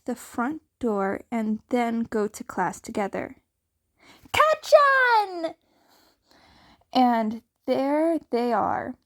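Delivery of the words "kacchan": loud, high call across the yard; bright excited yell of a friend's name. Acted. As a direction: shout his nickname happily. Zukun calling out to Katsuki.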